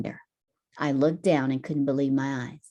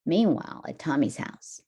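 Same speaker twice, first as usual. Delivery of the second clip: usual speaking voice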